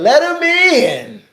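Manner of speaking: high, drawn-out crowd yell; yelling fans. Acted. Host voicing fans for Obafemi at the door, yelling. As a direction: holler it like a fan, stretching the words. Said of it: "the fans like us is yelling".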